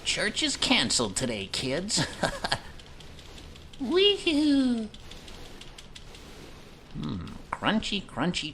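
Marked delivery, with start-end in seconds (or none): 2.1-3.7 high giggle. Real, not acted. Laughing at the chair-eating enemy. 3.8-4.9 gleeful whoop. Real, not acted. Gleeful cry while attacking.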